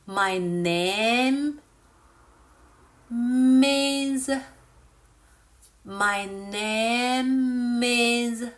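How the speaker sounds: "My name is" greatly drawn out, humming the m into "is"; said slowly. Shown as a demonstration. Teacher saying it slowly again, "My name is" stretched and linked. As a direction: stretch every word of "My name is", linking "name" to "is". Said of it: "I'll say it slowly again".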